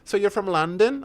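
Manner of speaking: a light, high-pitched put-on of a clueless American; mock American tourist. Mimicking Americans asking if England means London, lightly voiced. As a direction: ask it like a baffled tourist who only knows London.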